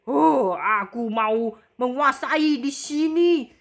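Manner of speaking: slow menacing giant; Titan monster voice. Acted. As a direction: drawl it out like a giant conquering the town. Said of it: "Hoi, Titan".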